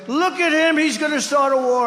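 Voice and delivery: high mocking imitation of Hillary; lightly voiced mocking Hillary. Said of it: "Crooked Hillary said".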